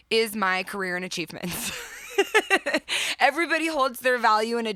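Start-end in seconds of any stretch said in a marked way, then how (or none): 1.8-3.1 laugh. Real, not acted. Laughs at own career confession.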